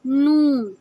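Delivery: loud, drawn-out "نون" with rounded lips; rounded Noon. Shown as a demonstration. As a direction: hold "نون", tongue tip on the palate. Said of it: "ہونٹوں کو گول کرتے ہیں". ("نون" ہونٹ گول کر کے، لمبا)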